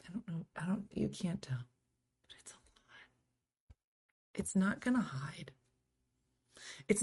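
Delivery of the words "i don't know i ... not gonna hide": softer whispered admission. Real, not acted. Host whispering to her viewers that the grays won't hide, a whisper. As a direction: breathe it like a confession. Confiding about visible grays.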